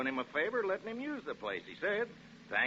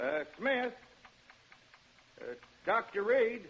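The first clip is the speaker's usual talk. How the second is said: higher, slower calls with growing unease; calling out; 9 semitones higher, slower than usual. Acted. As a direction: call out, waiting for an answer. Calling into empty house.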